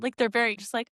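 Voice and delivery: ordinary delivery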